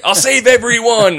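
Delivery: high, loud, drawn-out hero cry; mock heroic boy. Little boy jumping on Chucky's grenade, mock heroic cry. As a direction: play the self-sacrificing little boy. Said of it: "jumps on Chucky's grenade and is like".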